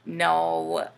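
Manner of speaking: ordinary delivery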